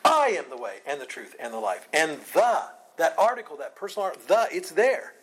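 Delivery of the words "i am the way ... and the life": "I" strongly stressed, the rest falling away; emphatic I. Shown as a demonstration. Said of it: "How does he say it?".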